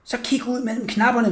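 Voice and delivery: ordinary delivery, nothing marked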